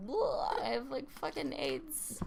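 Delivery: mock sufferer. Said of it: "you're Sean from Boy Meets World".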